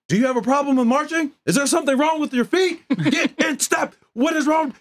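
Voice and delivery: high shouting drill instructor; drill instructor yell. Retold or imitated. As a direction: ride the recruit who can't march. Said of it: "he would talk like this".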